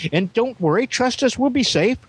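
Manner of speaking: high, sing-song fake reassurance; mocking high voice. Mocking the businesses' promises.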